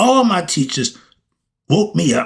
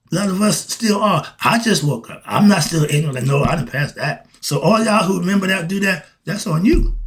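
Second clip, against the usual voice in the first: ordinary talk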